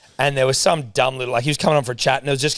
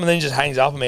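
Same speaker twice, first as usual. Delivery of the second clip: ordinary talk, nothing marked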